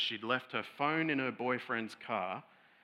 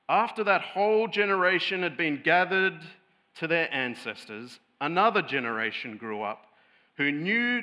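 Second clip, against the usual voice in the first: louder, grave reading of the text; solemn scripture reading; 3 semitones higher, 7 dB louder than usual. Acted. Pastor reading Judges to the congregation, solemn proclamation.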